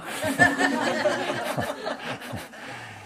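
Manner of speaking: a long airy laugh without voice; breathy laugh. Real, not acted. Teacher laughing after voicing her critic, airy laugh.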